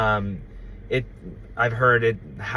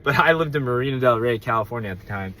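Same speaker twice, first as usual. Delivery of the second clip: ordinary delivery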